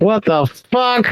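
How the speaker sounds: high, drawn-out incredulous swearing; mock outrage. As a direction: act a mobster baffled by the intruder's nerve. Mafia reacting to intruding cop.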